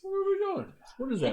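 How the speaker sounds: squealing with surprise; surprised high voice. Real, not acted. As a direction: leap up high, baffled. Surprised at the number.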